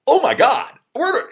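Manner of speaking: high, loud, stunned recognition; mortified shock. Retold or imitated. Student realizing the caller really is the director, mortified shock. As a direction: jump high in sudden horrified realization. Realizes he insulted the real Herzog.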